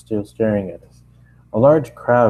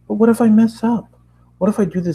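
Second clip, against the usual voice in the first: worried Twilight; 11 semitones higher, 4 dB louder, faster than usual. Acted. Twilight voiced worrying.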